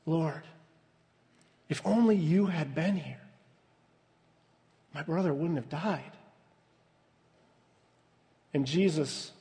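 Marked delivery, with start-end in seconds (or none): 0.1-6.1 pained. Shown as a demonstration. Preacher reading Martha's words to Jesus, softly pained. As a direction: let the grief sit in each word. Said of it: "You can hear the pain in those words".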